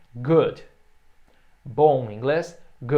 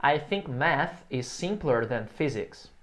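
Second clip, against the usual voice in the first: slow, clear teacher model; slow model sentence; slower than usual. Shown as a demonstration. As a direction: say it slowly for students to repeat. (frase modelo lenta e clara)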